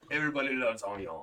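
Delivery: hammed-up, high onion enthusiast; video impression. Still quoting the video for friends, loud put-on voice. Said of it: "Have you seen that video?".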